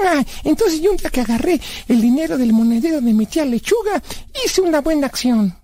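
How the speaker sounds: put-on high-pitched kid pleased with his deed; high cartoonish self-justification; childlike Blas, self-justifying. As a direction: boast naively in a high character voice.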